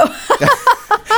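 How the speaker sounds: very high, amused "oh" into a laugh; surprised. Real, not acted. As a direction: squeal of surprise, then laugh. Friend mentions swig of coffee.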